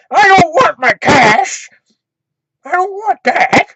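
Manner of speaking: the angry customer wails on the phone; mad, near tears. Retold or imitated. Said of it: "he's getting mad".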